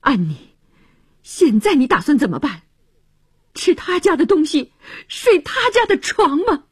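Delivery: furious questioning, building in pitch; flustered fury. A narrator's reading. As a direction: challenge them, anger mounting. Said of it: "他气急败坏地注视着".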